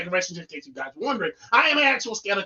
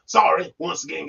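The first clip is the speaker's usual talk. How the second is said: usual speaking voice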